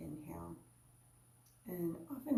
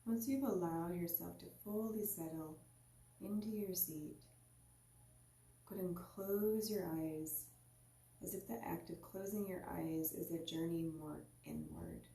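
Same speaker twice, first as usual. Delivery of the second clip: guided meditation. Acted. Teacher guiding listeners into their seat, calm meditation voice. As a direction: ease the listener inward, unhurried.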